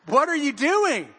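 exasperated laughing cry. Retold or imitated. Grandpa to grandson who threw apple down vent, amused disbelief.

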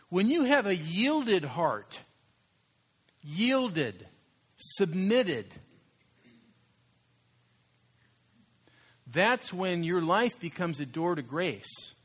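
slow impassioned sermon line; preaching emphasis. Acted. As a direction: lean into every word from the pulpit. Preaching emphasis on yielded heart.

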